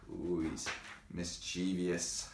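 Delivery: usual speaking voice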